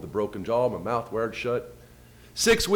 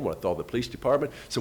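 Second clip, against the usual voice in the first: normal talking voice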